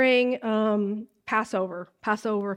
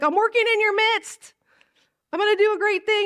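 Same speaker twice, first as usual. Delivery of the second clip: voicing Jesus; 9 semitones higher, 4 dB louder than usual. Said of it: "Jesus is like".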